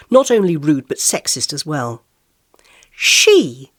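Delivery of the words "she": "She" said loud and drawn out; "She" loudly stressed, pointedly; loud emphasis. A narrator's reading. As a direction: punch the word "She" as a correction. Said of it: "I said loudly for emphasis".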